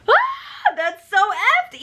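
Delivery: high, slow outraged gasp of disbelief; shocked. Real, not acted. Recapping the kiss snub to viewers, real shock. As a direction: let the shock drag it out.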